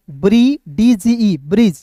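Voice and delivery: ब्रिज with the D silent, said as ब्रिज; letters called out slowly, then the word ब्रिज; slow spelling demo. Shown as a demonstration. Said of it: "डीजी एक साथ आएगा तो उसका उच्चारण".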